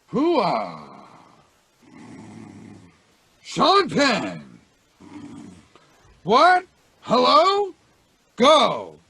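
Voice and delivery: loud gruff startled wake-up bark; Al Pacino startled awake. Acted. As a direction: jolt awake shouting like Pacino. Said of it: "I'll do Al Pacino being startled awake".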